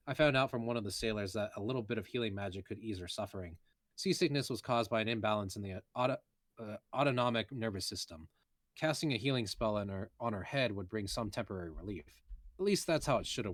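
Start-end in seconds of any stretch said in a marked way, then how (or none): none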